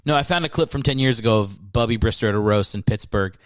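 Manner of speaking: ordinary talk, nothing marked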